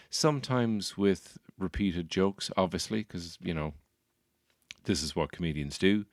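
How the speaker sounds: normal talking voice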